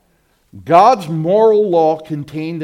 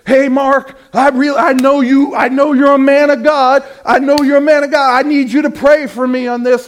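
louder and higher, a mock eager plea for prayer; imitated pleading churchgoer; 11 semitones higher, 9 dB louder than usual. Churchgoer begs the preacher to pray for him, eager and louder. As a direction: play the needy churchgoer hailing the pastor.

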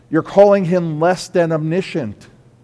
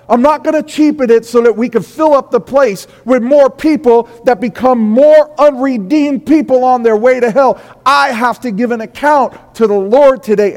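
much higher and louder fiery preaching; full-force preaching; 9 semitones higher, 9 dB louder than usual. Acted. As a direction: drive the warning home to the congregation.

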